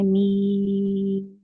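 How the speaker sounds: मी held long and drawn out; elongated recitation. Shown as a demonstration. Teacher demonstrating the madd on मी. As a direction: stretch मी in tajweed style. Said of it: "मी को हम six count खींचेंगे".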